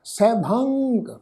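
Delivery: ਸੈਭੰਗ slow, with the nasal ng clearly sounded. Shown as a demonstration. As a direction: draw out ਸੈਭੰਗ and let the nasal ring. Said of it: "ਨਾਸਕੀ tone ਵਿੱਚ ਉਚਾਰਨ ਹੋਏਗਾ".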